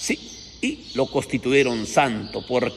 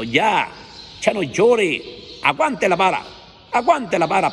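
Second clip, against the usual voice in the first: stern scolding; 6 semitones higher than usual. Acted. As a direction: scold her hard to quit crying. Preacher scolding weeping parent.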